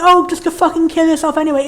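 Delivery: ordinary talk, nothing marked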